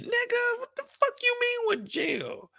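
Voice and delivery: can't believe she asked that; outraged disbelief. Retold or imitated. Reacting to the jail question.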